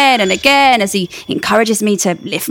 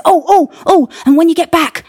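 eager photographer; 8 semitones higher, 4 dB louder than usual. Acted. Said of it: "he says".

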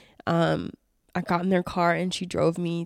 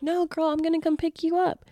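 much higher, insistent friend voice; friend insisting; 9 semitones higher than usual. Retold or imitated. Friend insisting on the airport pickup, higher voice. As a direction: insist on picking her up. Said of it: "she's like".

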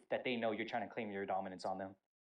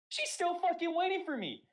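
high, loud, slowed exclamation; dramatic disbelief; 4 dB louder, slower than usual. Retold or imitated. Recruit peeking up to find the sergeant still staring, high and loud disbelief. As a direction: stretch it out in high-pitched disbelief.

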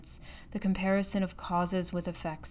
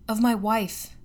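impatient reply; 3 semitones higher, 5 dB louder than usual. A narrator's reading. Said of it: "I replied impatiently".

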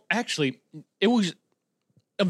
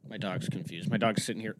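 ordinary delivery

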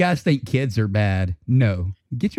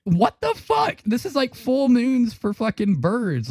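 high squeal of mock disbelief; 11 semitones higher than usual. Reacting to the bird's freak-out in high mock shock. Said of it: "And I'm like".

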